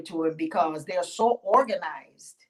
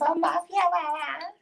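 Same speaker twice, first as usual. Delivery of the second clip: sweet greeting; 11 semitones higher than usual. Acted. Yemonja greets her husband Ogun.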